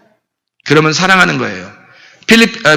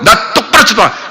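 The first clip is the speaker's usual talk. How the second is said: parent scolding; 3 semitones higher than usual. Retold or imitated. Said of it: "그런, 그런다고요".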